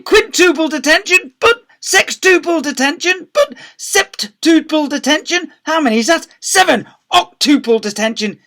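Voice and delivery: high, shouted escalating tirade; ranting rampage. A narrator's reading. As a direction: bellow each punishment bigger and madder. Deputy head escalates into a rampage.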